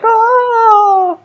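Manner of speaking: high, loud shocked gasp; a whole room gasping in shock; crowd gasp. Retold or imitated. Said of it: "the whole audience is like".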